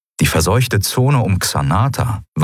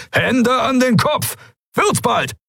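much higher, slow, piercing shouted command; shrill yell; slower than usual. A narrator's reading. The pirate yells the order through the whole hangar, piercing shout. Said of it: "Der Befehl gellte durch den Hangar".

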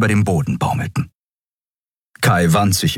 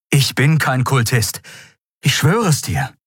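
higher-pitched, insistent oath; vehement protest; 7 semitones higher than usual. A narrator's reading. Kai swears he is no cultist, vehemently. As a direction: swear it urgently. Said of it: "mit derselben Heftigkeit wie zuvor".

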